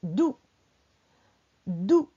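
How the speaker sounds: "dou" cut short, not held; short vowel. Shown as a demonstration. Teacher dictating a syllable to write, "dou" kept short. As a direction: say a brief, low "dou" with no stretch. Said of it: "elle n'est pas tirée".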